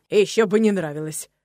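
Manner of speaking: loud, snorting comeback from a horse; snorting retort. A narrator's reading. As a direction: snort it out emphatically. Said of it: "фыркнул Кони из соседнего стойла". (фыркнуть громко, с напором)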